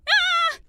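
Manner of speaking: short, high, loud shriek; high-pitched startled yelp; mock scream. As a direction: let out a sudden acted squeal. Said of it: "and going".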